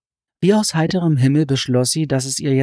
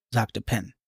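ordinary delivery

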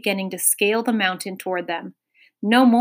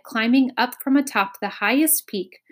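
ordinary delivery, nothing marked

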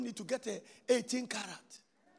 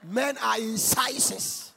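slow declaimed proverb; 4 dB louder, much slower than usual. Real, not acted.

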